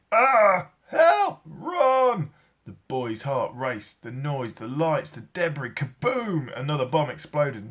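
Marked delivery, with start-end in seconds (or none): 0.1-2.3 high loud panicked screams; screaming for help. A narrator's reading. People scream as bombs fall on London, loud high panicked shouts. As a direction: cry out in terror. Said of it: "people screaming and shouting".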